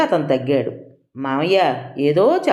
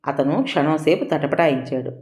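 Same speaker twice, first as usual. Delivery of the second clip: ordinary delivery, nothing marked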